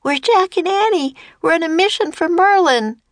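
put-on high-pitched defiant child's shout; shouting back. A narrator's reading. Annie answering the monster's challenge, shouting back high. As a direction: pipe up boldly, pitch way up. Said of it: "Annie shouted back at the monster".

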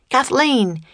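high, lilting call to a friend. A narrator's reading. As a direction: call the name across a distance. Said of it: "he called".

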